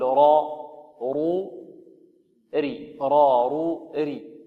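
the ra drilled with each vowel, heavy را and رو, light ري. Shown as a demonstration. Teacher drilling the ra with each vowel. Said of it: "تقول".

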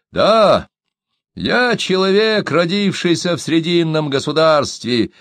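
storyteller voicing a proud man. A narrator's reading. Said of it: "ответил Диан гордо".

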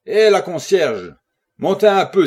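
calling the concierge with full voice; loud shout. A narrator's reading. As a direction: shout down the stairwell insistently.

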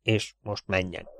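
soft, slow dismissal; hushed and deliberate; guilty undertone. Acted. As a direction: send someone off quietly, guiltily. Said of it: "bűntudatosan nézett körbe".

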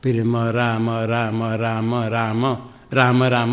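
slow, even repetition of "मरा" running into "राम"; measured syllables, "ma-ra-ma-ra" sliding into "rama"; repeated demonstration. Shown as a demonstration. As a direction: loop "मरा" steadily until it turns into "राम". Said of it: "इसलिए कहते हैं मरा कहो".

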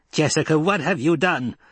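high-pitched, alarmed accusation; demanding. A narrator's reading. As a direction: demand an explanation as the old woman. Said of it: "the old woman demanded".

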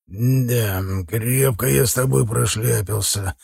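hoarse, wheezy voice; raspy whisper. A narrator's reading. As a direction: rasp it out hoarsely. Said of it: "просипел Толстый".

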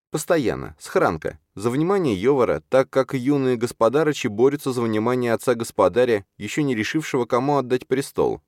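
put-on high, earnest young character voice; insistent Чарна. Чарна tells Юрген he outdoes Хранка, insistent.

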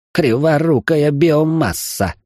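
high, drawn-out robotic swearing; cursing robot. Acted. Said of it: "выругался робот". (ругань робота высоким голосом)

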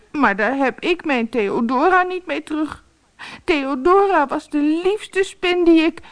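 put-on high boy's voice, sorrowful; sad little boy. Boy mourns his spider. (hoge kinderstem, bedroefd)

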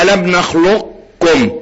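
slow model of "نخلقكم" with a trace of qaf; incomplete idgham. Shown as a demonstration. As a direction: keep "نخلقكم" heavy at the qaf, then kaf. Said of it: "إدغام ناقص".

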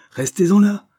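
high, loud command; angry cry. A narrator's reading. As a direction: cut him off angrily. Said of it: "cria Cornélie avec colère". (ordre crié avec colère)

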